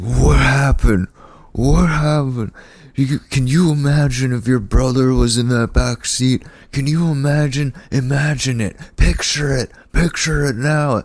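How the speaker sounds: put-on drunk dad. Drunk dad on the phone scolding his son in hospital, high and slurred.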